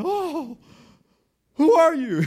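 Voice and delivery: very loud, high-pitched acted outburst; overjoyed shouting. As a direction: holler it like greeting the first person in years. Castaway greets shipwrecked stranger wildly.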